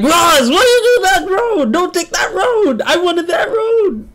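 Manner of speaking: high-pitched, drawn-out mock scolding; whine-yell it way up high, stretching the words; Bio yelling. Gamer imitates a teammate blaming them for the road, mock yelling. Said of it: "getting yelled at by Bio".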